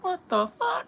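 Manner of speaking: sudden very high squeak of disbelief; shocked squeal. Real, not acted. Streamer spotting a name in his chat, high shocked squeak. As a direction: squeak it out, caught off guard.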